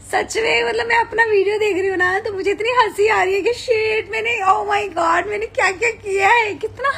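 high, laughing, half-crying gush; laughing disbelief. Real, not acted. Streamer to fans, watching her old clips, laughing disbelief. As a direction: giggle through it, amazed at yourself.

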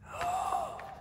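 sharp high gasp of reveal; quick theatrical gasp; excited high gasp. Real, not acted. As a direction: gasp to build suspense. Quiz answer reveal.

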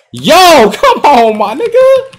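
high, loud laughing hype yell; yelling hype at his own green shot; hyped shout. Real, not acted. Gamer hyping his shot to viewers, loud laughing yell.

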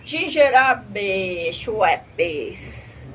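very slow stretched product name; slow drawl. Acted. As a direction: drag out each syllable.

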